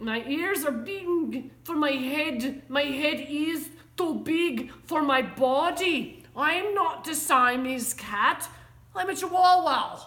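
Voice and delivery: loud, amateurish mock-Spanish accent; attempted Spanish accent. A narrator's reading. Kitten declares he is a Chihuahua, attempted Spanish accent. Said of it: "using his very best Spanish accent".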